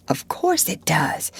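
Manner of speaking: hushed, certain whisper. Acted. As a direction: whisper it back firmly. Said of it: "They continued to whisper".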